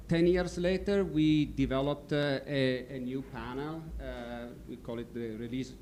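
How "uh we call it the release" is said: normal talking voice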